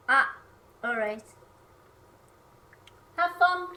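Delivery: ordinary talk, nothing marked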